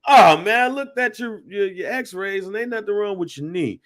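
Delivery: loud "Oh, man", then high, breezy brush-off; hammed-up doctor, opening big and high, then easing off; dismissive doctor, exaggerated. Mimicking the doctor who found nothing wrong, exaggerated dismissal. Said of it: "No, this man was like".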